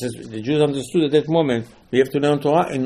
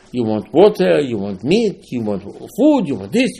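ordinary delivery

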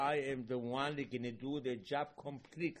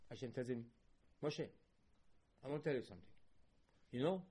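ordinary talk, nothing marked

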